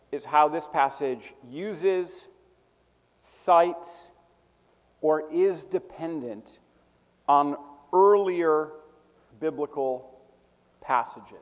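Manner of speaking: slow dictation, pausing after each key word; spaced out, key words stressed; dictating. Real, not acted. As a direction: give it piece by piece for note-takers. Dictating a definition to students.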